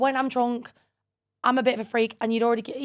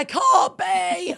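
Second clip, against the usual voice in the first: loud, higher and slowed, in mock denial; disbelieving shout; 8 semitones higher, 5 dB louder, much slower than usual. Retelling to her friend how she yelled at Chloe, a mock disbelieving shout. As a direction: drag out a stunned yell of refusal.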